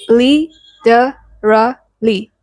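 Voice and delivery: li, de, ra, li spaced out, the T turned to a soft D; syllable by syllable. Shown as a demonstration. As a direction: break literally into four slow beats. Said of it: "the T sounds like a soft D".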